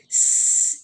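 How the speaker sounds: س as a long voiceless whistling hiss; bare hiss. Shown as a demonstration. Teacher isolating the seen sound for students, voiceless hiss. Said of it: "یہ والی sound آئے گی".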